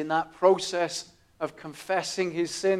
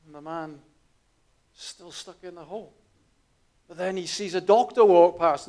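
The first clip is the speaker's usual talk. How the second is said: ordinary talk, nothing marked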